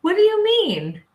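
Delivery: high, laughing imitation of Tom; high squeaky retold friend; mock friend. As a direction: act his confused logic, laughing. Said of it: "and goes".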